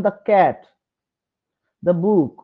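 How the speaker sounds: "the" said as duh before "cat" and "book"; short open vowel in "the"; the as thuh. Shown as a demonstration. Teacher showing words starting with consonants, "the" as duh. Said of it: "consonant sound দিয়ে শুরু হচ্ছে".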